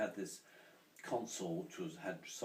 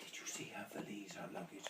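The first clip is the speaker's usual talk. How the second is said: gossipy stage whisper; hushed, imitated landlady's gossip; confidential whisper; 9 dB quieter than usual. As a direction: whisper it like scandalous news.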